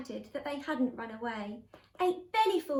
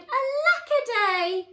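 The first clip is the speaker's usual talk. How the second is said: high, loud, drawn-out cry; wailed lament; 9 semitones higher, 8 dB louder, slower than usual. A narrator's reading. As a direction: wail it out theatrically. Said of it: "He cried".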